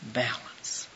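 1 whispered balance. Real, not acted. Savouring the word.

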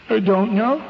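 put-on high little-child voice, rising at the end; childlike, upset answer lifting in pitch; tearful little girl. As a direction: imitate a crying six-year-old. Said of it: "A little six-year-old girl".

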